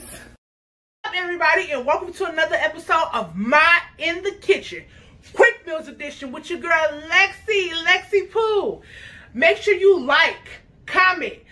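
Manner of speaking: high, loud, excited youtuber greeting; hyped vlog intro. Acted. Host greeting her viewers, high and excited. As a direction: pump up the viewers like a hype host.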